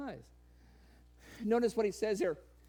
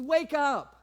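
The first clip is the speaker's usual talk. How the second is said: sharp wake-up call; 8 semitones higher, 10 dB louder than usual. Retold or imitated. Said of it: "He says".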